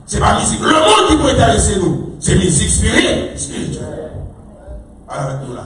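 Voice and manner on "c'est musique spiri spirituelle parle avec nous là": normal talking voice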